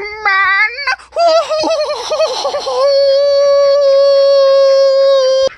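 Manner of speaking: loud high gloating villain cackle; evil cackle. Pretend. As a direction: cackle like a cartoon supervillain. Villain's triumphant laugh.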